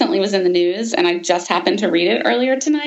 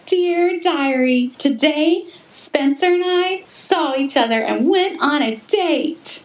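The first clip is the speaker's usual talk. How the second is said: put-on girly diary voice; 6 semitones higher than usual. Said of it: "it said".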